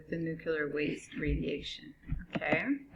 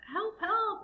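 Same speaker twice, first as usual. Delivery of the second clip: high crying voice on phone; tinny talking telephone; 13 semitones higher than usual. Acted. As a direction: plead through a tinny phone line. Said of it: "they say".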